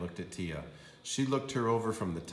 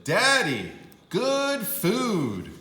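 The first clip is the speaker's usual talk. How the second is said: put-on much higher and louder, happy kid; proud little girl showing off; eager child's squeal; excited child; 12 semitones higher, 8 dB louder, much slower than usual. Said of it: "Tia said".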